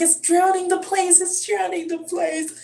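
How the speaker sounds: imitating the little boy in tears; high scared wail, slowing at the end; panicked child. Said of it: "He's like".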